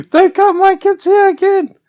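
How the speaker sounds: shrieked high and loud like a furious aunt; mock angry auntie. Auntie banning him from cutting her kids' hair, mock furious high shout.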